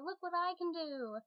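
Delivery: an eager little son shouting for his dad; excited small child's cry; little boy calling. Pretend. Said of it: "bring out and be like".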